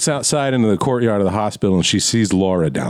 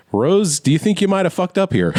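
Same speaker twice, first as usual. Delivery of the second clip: pointed question; 9 semitones higher than usual. Retold or imitated. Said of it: "he's like".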